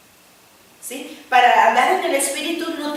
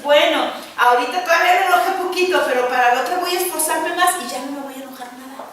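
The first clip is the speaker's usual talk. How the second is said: louder, eager resolution, building up; excited self-talk; 3 semitones higher, 6 dB louder than usual. Retold or imitated. A believer vowing to stop getting angry, loud and eager. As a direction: cheer yourself on to try harder. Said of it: "Al ratito dices".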